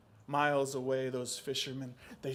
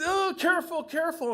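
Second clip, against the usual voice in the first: high, loud anxious caution; alarmed warning; 13 semitones higher, 6 dB louder than usual. Acted. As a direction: fret as the box cracks. Grandfather alarmed at cracking box.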